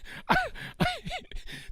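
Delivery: a high, breathy burst of laughter. Real, not acted. Host laughing at a shoutout.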